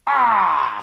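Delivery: loud monstrous roar. Acted. Peace of Westphalia roars at the little man, loud roar. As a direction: bellow a huge Ah. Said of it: "Rugit Paix de Westphalie".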